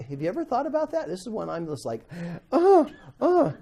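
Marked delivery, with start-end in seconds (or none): 2.5-3.5 high, whiny mock grumbling noise; mock grumble. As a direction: groan like someone grumbling. Imitates grumbling sound.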